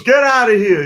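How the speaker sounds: amused Caco waving them off; Caco's voice. Retold or imitated. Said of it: "'Cause he talked like this".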